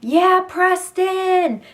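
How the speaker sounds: high, loud, drawn-out yell; slow yell across the crowd; excited yell. Retold or imitated. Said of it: "and then I said".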